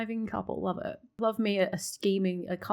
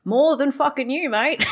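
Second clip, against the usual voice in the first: MORE than fucking you, pushed out higher and louder; louder mock retort; 4 semitones higher, 7 dB louder than usual. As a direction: turn up the comeback, heavy on MORE. Streamer retorts at a game character.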